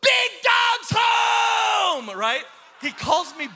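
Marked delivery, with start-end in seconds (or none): 0.0-2.1 high, drawn-out holler, imitating a boy; imitating an excited son shouting; son's shout. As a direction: bellow it like a kid announcing dad's return. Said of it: "he shouts it".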